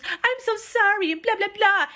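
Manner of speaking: high, slow, syrupy fake apology; mock fake apology. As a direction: drag out a sugary sorry for the men. Waitress fawning over men.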